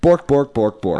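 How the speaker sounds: mock Swedish gibberish. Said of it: "That's what Swedish sounds like to me".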